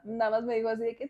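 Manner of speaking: normal talking voice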